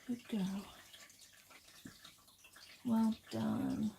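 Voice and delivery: calm the frightened animal down; soothing. Real, not acted. Comforting a scared pet.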